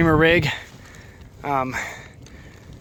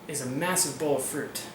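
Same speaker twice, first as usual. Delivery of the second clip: news anchor; 6 dB quieter, slower than usual. Acted.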